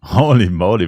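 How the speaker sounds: astonished exclamation. Real, not acted. Streamer at ultra-long dialogue, astonished exclamation. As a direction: burst out, taken aback.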